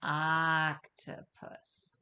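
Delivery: stretched out slowly, sound by sound; slow drawn-out Octopus. Shown as a demonstration. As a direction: say octopus very slowly so kids copy. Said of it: "Say it slowly with me".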